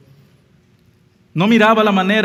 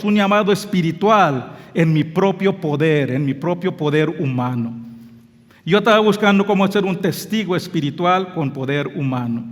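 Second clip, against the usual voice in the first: ordinary talk, nothing marked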